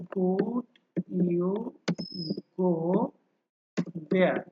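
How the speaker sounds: could, you, go, there as separate words with long pauses; word-by-word pronunciation. Shown as a demonstration. Teacher shows students isolated words, each pronounced separately. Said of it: "এটা যখন আমরা সাধারণভাবে উচ্চারণ করি".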